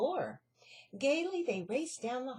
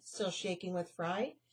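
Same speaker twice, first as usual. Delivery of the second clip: usual speaking voice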